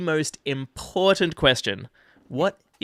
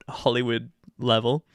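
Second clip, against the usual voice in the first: ordinary talk, nothing marked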